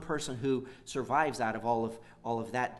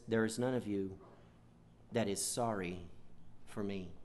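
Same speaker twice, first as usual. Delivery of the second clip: quiet, softer, whining self-pity, fading away; self-pitying whine; 5 semitones lower, 6 dB quieter than usual. Retold or imitated. Preacher voicing Saul's self-pitying complaint as a soft whine. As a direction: whimper it like a sulking king feeling sorry for himself. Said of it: "you can almost hear the whine in his voice".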